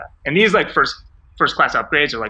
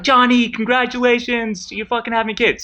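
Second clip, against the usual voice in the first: much higher, slowed, gushing stranger voice; excited stranger caller; 12 semitones higher, 3 dB louder than usual. Retold or imitated. Random Burning Man caller congratulating John, high and gushing. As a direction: play a stoned festival caller cheering John on. Festival strangers call John's number.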